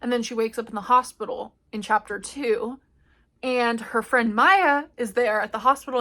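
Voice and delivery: ordinary talk, nothing marked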